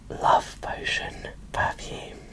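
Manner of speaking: ordinary talk, nothing marked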